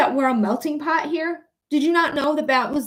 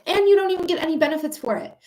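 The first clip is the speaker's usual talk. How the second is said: normal talking voice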